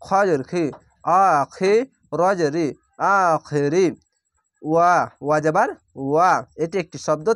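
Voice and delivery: ordinary talk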